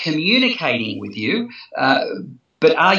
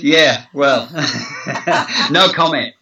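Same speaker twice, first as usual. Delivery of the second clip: laughing. Real, not acted. Guest dodges marriage joke.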